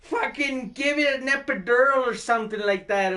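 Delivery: pained shout. Retold or imitated. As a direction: howl like your ankle just snapped. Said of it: "I'm like".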